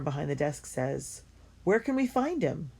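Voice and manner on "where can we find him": eager. Acted. Eager man from the desk.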